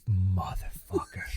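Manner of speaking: slow, voiceless swear of dread; whispered curse. Retold or imitated. Fan retells watching the kickoff return, cursing in a whisper. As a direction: curse under your breath, drawn out.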